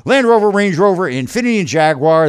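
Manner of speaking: upbeat radio ad pitch; ad read. Acted. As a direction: sell it like a car dealer spot. Host reads live car ad.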